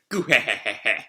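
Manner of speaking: acted cackle in a run of ha-has; put-on evil laugh; villain chuckle. Acting Orochi's amused laugh.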